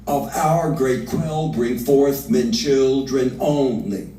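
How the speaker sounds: slow, heightened verse reading; Lady Macbeth's plotting speech, read slowly; dramatic reading. Acted. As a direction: give the verse full weight.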